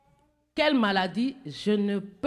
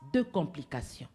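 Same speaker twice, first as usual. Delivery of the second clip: usual speaking voice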